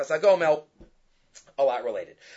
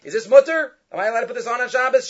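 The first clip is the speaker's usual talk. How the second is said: higher, louder startled asking, rushed at the end; incredulous questioner; 8 semitones higher, 5 dB louder, faster than usual. Retold or imitated. Man asking the rabbi if ointment is allowed, incredulous. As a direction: play the doubter demanding an answer, fast.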